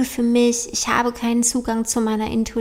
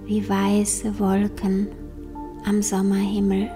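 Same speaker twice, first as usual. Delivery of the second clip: slow, soft meditation voice; guided meditation; 5 dB quieter, slower than usual. Acted. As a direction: guide them gently into calm, slowly.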